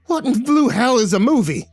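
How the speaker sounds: high, loud outraged holler; high-pitched indignant yelling; leprechaun shout. A narrator's reading. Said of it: "The leprechaun shouted".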